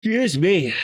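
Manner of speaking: said through a big yawn. Real, not acted. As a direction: let the yawn stretch the words. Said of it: "for the yawn".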